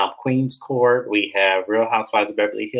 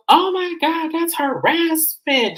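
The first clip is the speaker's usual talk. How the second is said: very high, slow pearl-clutching gasp; mock shocked outrage; slower than usual. [name] gasping at Jazzy closing the door, mock outrage. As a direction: squeal the scandal far up.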